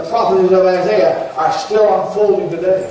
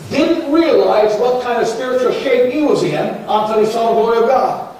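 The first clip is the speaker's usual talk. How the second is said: normal talking voice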